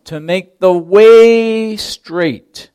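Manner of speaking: slow down and punch the word way; stress on way. Shown as a demonstration. Preacher voicing John, leaning hard on way. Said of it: "Notice that emphasis on the word way".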